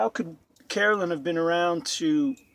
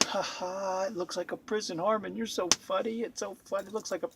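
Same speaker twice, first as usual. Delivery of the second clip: quiet, hammed-up fake amusement; mock laughing along; 7 dB quieter than usual. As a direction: imitate people finding it hilarious, softly. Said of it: "they both crack up laughing".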